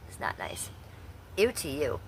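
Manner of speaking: whispered aside. Real, not acted. Scolding a rude chatter, whispered.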